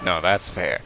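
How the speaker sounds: ordinary delivery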